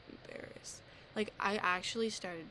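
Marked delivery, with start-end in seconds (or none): 0.1-0.8 breathy whisper, trailing off; ashamed whisper; whispered embarrassed. Real, not acted. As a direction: drop to a whisper on the word.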